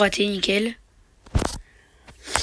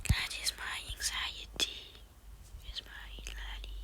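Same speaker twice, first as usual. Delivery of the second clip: slow, much softer whisper; whispered aside; 18 dB quieter, much slower than usual. Real, not acted. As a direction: breathe it out to yourself, slowly. Mutters English meme under breath. (chuchoté tout bas, lentement)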